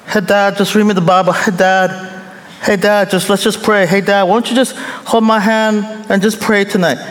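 put-on high, fast whiny child begging dad; whiny kid. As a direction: beg like a lazy kid at bedtime. Pastor voicing his whining kids.

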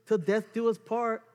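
high, loud fake glee; mock delight. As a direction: gush sarcastically about staying together forever. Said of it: "nothing in me goes".

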